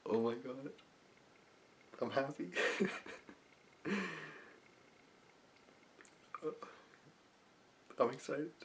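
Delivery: softly thrilled, overcome with laughter; overjoyed visualization. Shown as a demonstration. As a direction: breathe out each feeling in happy disbelief. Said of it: "another example of how I do this".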